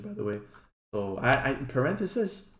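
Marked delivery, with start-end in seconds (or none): 1.5-2.5 "parenthesis" tried out with rising, questioning pitch; hesitant, higher, questioning "parenthesis"; unsure pronunciation. Real, not acted. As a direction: try "parenthesis" as if unsure how it's said. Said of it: "don't know how to say that word".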